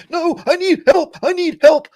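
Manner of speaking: high, loud mock cry for help; exaggerated high-pitched desperate call; stranded biker's plea. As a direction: yell it like a biker stuck on the roadside. Acts out stranded biker calling.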